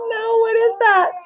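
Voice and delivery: high, surprised question. Real, not acted. Reading to a child, a high surprised question. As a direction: react with amused disbelief.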